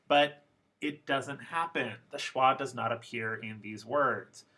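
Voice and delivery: ordinary talk, nothing marked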